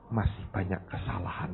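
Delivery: put-on whispered child's confession. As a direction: breathe it, no voice. Son answers why afraid.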